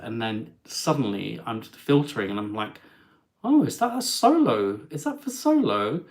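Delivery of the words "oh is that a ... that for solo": high, incredulous double-check; high-pitched astonished questioning; retold disbelief. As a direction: act out spotting a deal too good to be true. Retold surprise at cruise deal.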